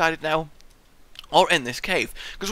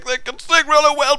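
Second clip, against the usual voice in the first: ordinary talk, nothing marked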